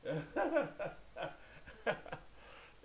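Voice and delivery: high, breathy burst of laughter; real laugh. Real, not acted. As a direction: laugh helplessly at your own commentary. Amused at own performance.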